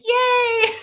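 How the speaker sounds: sudden high, loud squeal of joy; gleeful cheer. Retold or imitated. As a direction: cheer like an overjoyed dad. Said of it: "who's like".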